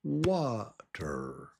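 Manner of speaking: "water" slowly split as WA, der, first part stressed; split into syllables. Shown as a demonstration. Teacher breaks down the word, "water" as stressed WA then der. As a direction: break "water" in two, strong then weak. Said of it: "So the first syllable is wa".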